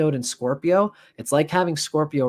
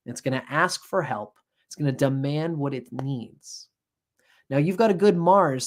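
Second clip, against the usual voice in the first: usual speaking voice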